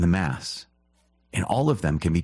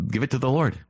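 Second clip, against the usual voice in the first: higher, urging exhortation; emphatic call; 8 semitones higher than usual. Real, not acted. Priest urging his listeners, higher and emphatic. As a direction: lift it up to the Lord.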